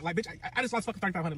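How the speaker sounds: ordinary talk, nothing marked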